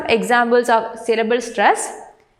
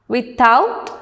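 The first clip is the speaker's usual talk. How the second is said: "without" with the weight on OUT; stress on second syllable. Shown as a demonstration. Teacher reading words to students, "without" stressed on OUT. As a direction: say "without" as with-OUT, rising at the end. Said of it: "stress on the second syllable".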